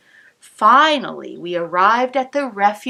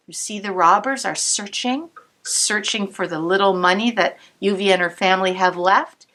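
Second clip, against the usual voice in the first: ordinary delivery, nothing marked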